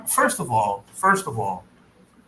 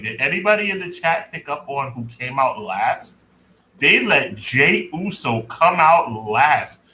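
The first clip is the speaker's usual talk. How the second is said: normal talking voice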